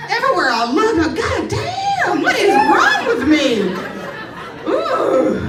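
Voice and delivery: high, slow mock-horrified cry, laughing through it; horrified yelp. Seeing herself in the mirrors, high horrified yelp. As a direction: stretch it out, squealing in disbelief.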